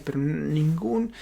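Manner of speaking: ordinary talk, nothing marked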